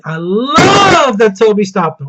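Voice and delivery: long swooping "love"; slow, heartfelt fan gush; gushing emphasis. Real, not acted. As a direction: stretch LOVE out, high and loud. Gushing about a favourite moment.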